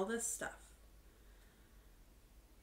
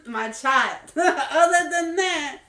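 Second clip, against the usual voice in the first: laughing; 7 semitones higher, 14 dB louder, slower than usual. Real, not acted. Laughing about her fur baby.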